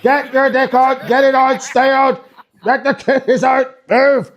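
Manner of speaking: hammed-up Ian Paisley-style orders; bossy Northern Irish accent. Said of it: "she'll be like".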